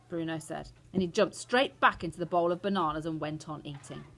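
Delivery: ordinary delivery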